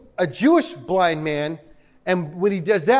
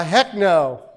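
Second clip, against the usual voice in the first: ordinary talk, nothing marked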